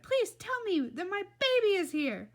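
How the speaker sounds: high, loud desperate plea; shouted plea. A narrator's reading. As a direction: cry out as a frantic mother. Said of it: "she shouted".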